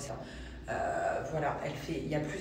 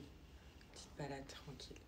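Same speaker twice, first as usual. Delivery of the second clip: whispering; 2 semitones lower, 17 dB quieter than usual. Real, not acted. Whispering while baby sleeps.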